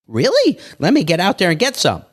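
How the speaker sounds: high, eager mock surprise; mock excited "really". A gullible friend hearing a tip, a high eager reply. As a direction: jump at the tip with fake excitement.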